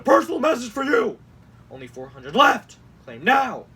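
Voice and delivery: sudden loud bursts on the capitalized words; mock all-caps shouting. Host reads campaign texts to mock them, shouting the capitals. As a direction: belt it out like an overexcited ad. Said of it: "my throat's gonna be dead".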